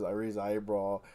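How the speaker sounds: ordinary talk, nothing marked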